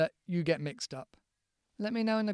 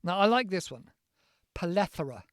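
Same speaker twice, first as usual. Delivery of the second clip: ordinary delivery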